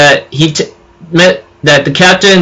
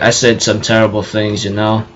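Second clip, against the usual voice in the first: quiet apology; 6 semitones lower, 7 dB quieter than usual. Retold or imitated. Bond apologizing to Hawk.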